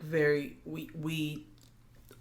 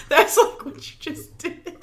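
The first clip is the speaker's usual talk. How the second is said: ordinary talk, nothing marked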